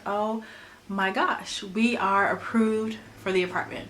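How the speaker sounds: ordinary delivery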